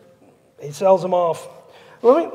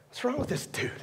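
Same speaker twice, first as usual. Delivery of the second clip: hushed muttering; 17 dB quieter than usual. Acted. Onlookers muttering.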